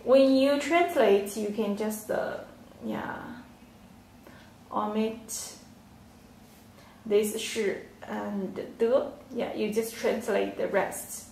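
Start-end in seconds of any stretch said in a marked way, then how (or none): none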